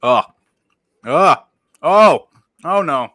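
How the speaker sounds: loud, high cries of disgust; disgusted shouts. Real, not acted. Tasting grape Skittles, shouting loud and high in disgust. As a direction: yell oh at the nasty taste. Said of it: "That tastes like medicine".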